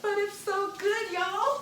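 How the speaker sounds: laughing. Real, not acted. Tasting jam, laughing delightedly.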